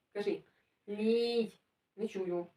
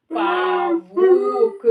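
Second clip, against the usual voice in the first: loud demo. Shown as a demonstration. Teacher shows the child how to say it, loud and drawn out. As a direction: sound it out at full voice. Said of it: "А громко надо казати".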